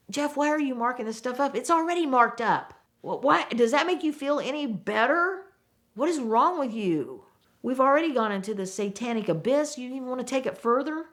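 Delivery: high, strained exasperated scolding; exasperated rant. Retold or imitated. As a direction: vent your disbelief at a coworker.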